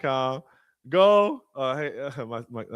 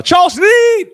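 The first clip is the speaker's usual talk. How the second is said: high, loud name call; hype shout-out; 12 dB louder than usual. Real, not acted. Host shouting out the event manager, excited. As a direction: call him out like an MC.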